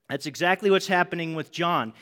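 ordinary delivery

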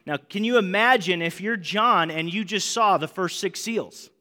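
ordinary delivery

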